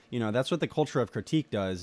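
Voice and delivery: ordinary talk, nothing marked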